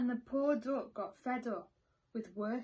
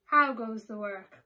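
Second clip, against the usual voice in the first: farmer calling out loudly; 9 dB louder than usual. A narrator's reading. Lazy farmer calls out loudly to the duck. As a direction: bellow it from bed as the farmer. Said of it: "called the farmer".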